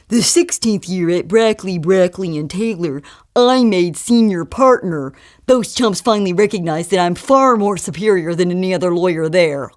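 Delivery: pompous lawyer in a put-on voice; self-absorbed jerk. Self-absorbed lawyer to his date about his career, pompous. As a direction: brag about your brilliant career. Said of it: "classic self-absorbed jerks who can only talk about their brilliant careers".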